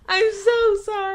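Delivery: high shrieking laugh, then apology; real laughing fit at her own slip; burst of laughter. Real, not acted. Cracks up at her mistake.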